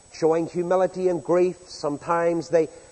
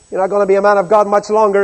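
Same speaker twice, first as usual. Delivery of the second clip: louder, fast jeering taunt; loud sarcastic threat; 4 semitones higher, 11 dB louder, faster than usual. Retold or imitated. Preacher voicing the captain threatening Elijah, loud jeer. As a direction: spit out the threat mockingly. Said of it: "is the implication".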